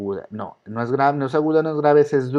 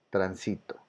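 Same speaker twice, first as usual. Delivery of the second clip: transito said low and soft, weight on SI; stress on middle syllable; 6 semitones lower, 12 dB quieter than usual. Shown as a demonstration. Teacher repeats transito as a grave, middle syllable stressed. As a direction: softly repeat transito, leaning mid-word. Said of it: "Es grave".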